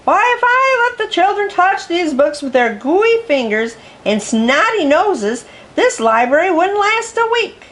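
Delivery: high, indignant dragon voice; indignant dragon librarian. Acted. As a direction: scold them like a fussy dragon lady. Dragon librarian scolds the teachers.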